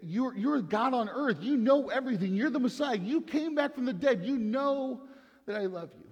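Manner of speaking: high-pitched, insistent, exasperated pleading. Acted. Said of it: "Peter's the one who's exasperated".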